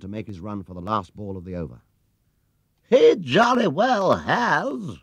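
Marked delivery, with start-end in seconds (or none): 2.9-5.0 high, loud, slow jubilant shout; triumphant cry. A narrator's reading. Spectator shouts over the applause, jubilant. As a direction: call out over the crowd. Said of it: "cried someone over the applause".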